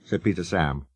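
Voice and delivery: plain reading voice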